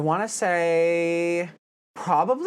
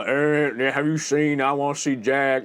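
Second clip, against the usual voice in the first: marbles in mouth; 3 semitones lower than usual. Retold or imitated. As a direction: talk like marbles fill the mouth. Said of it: "'cause that's how he talks".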